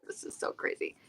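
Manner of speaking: whispered. Pretend. Whispered aside, amazed.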